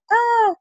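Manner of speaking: squeaky monkey squeal. Retold or imitated. Imitating the monkey emoji.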